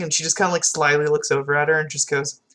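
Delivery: usual speaking voice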